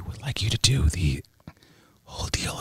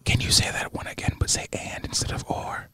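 whispering; 6 dB quieter than usual. Acted. ASMR whisper request.

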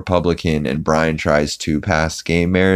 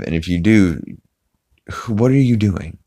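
usual speaking voice

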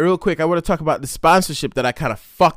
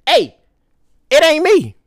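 high, loud protest; shouting; 12 semitones higher, 10 dB louder, slower than usual. Real, not acted. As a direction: protest loudly it's not you. Blaming the dog.

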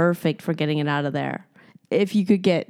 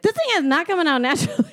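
high, fast burst of protest; panicked protest; 9 semitones higher, faster than usual. Retold or imitated. Texting a friend back about it, panicked protest. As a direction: blurt it out in a high-pitched rush. Acts out her panicked text reply.

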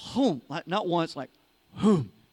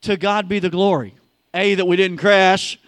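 louder ringing declaration; louder proclaimed "To God be the glory"; 11 dB louder than usual. Acted. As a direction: shout "To God be the glory" out to the congregation. Proclaimed after the crash joke.

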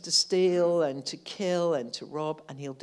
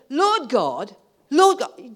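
fervent cry; 7 semitones higher, 9 dB louder, slower than usual. Pretend. Preacher acting a fervent prayer, building loud cry. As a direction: Lord God rising higher and louder the second time. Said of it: "Or do you say".

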